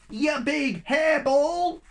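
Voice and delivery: slow, high, loud jeer; cheeky insult. Acted. A snowball insults the biggest yeti, high and loud.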